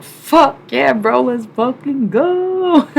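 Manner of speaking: loud, high, drawn-out hype yell; hyped-up yes. Acted. Streamer acting out a reply to an invite, hyped-up. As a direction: whoop it out, stretching the last word.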